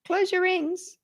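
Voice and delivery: high-pitched chirpy watch alert; smartwatch reminder. Retold or imitated. Apple Watch nagging its wearer, high mock-reminder voice. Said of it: "it does go".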